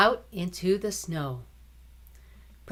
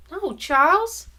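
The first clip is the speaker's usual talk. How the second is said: put-on girl's weary denial; 12 semitones higher, 5 dB louder than usual. Sick girl answering brother.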